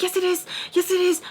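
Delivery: high, frantic insistence, retold; freaking out. Girl arguing the visitor is hers, retold freak-out. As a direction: argue back in a mock panic. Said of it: "like, freaking out".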